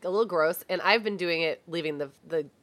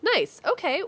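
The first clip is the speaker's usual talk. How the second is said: ordinary talk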